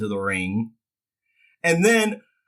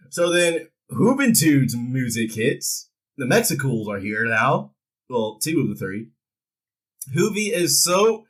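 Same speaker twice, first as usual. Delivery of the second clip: ordinary talk, nothing marked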